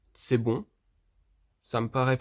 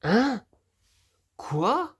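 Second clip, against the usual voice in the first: startled; 8 semitones higher than usual. Real, not acted. Part came off by itself.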